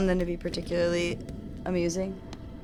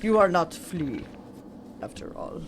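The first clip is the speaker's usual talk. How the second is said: deep mysterious flea voice; 5 semitones lower, 3 dB quieter than usual. Pretend. Same flea character warns.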